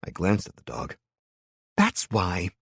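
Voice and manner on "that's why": pleased with her own logic; bright child. A narrator's reading. Little girl brightly explains the dog's name. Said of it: "smiling brightly".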